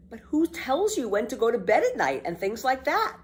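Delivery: put-on high, delighted little girl; eager girl Annika. Annika agrees to breakfast, high delighted girl voice. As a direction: jump at the idea.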